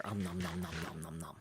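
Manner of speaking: whispered munching noises; messy loud eating. Pretend. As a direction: softly mimic chewing, nom nom. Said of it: "Sorry, I'm a little messy eater".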